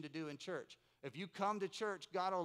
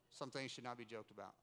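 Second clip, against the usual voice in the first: normal talking voice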